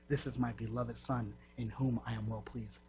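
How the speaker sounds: ordinary talk, nothing marked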